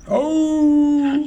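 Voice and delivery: very high, drawn-out "au" howl. A narrator's reading. Boy fakes bellyache before the robber, howling. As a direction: howl in pretended pain. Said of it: "heulte Seppel".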